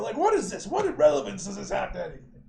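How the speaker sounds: loud annoyed griping; exasperated rant. Real, not acted. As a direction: vent at the comic. Annoyed at irrelevant comic page.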